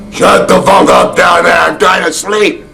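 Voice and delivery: high-pitched mock shout, slowing at the end; retold yell. Storyteller acts Bob yelling out from the hotel door, mock shout. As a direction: holler it like a guest yelling downstairs. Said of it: "he yells out".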